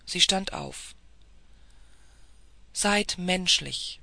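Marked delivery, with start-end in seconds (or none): none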